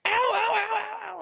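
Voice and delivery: high wailing ow, ow; mock kid's howl. Imitating his son crying out after the flick. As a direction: wail like a flicked kid overreacting. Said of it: "he just starts".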